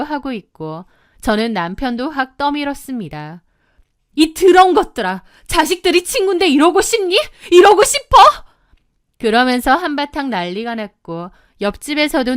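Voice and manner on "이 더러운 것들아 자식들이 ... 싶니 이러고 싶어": high, loud shouting, climbing to the last question; furious outburst. Acted. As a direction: berate the cheating pair at full voice. Wife berates cheating husband and neighbour. (분노에 차서 호통치는 고함)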